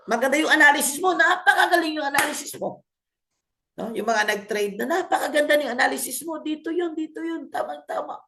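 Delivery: high-pitched, eager self-assurance, lightly acted; mildly acted enthusiasm. Trader admiring his own chart analysis, mildly acted enthusiasm. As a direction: play the trader sure of a great setup.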